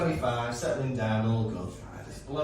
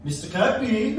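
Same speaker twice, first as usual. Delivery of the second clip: lightly imitated German schoolchild accent, pitched up; a hint of German accent, higher voice; German child's accent; 5 semitones higher than usual. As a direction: say it like a German pupil, amused. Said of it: "This is the accent".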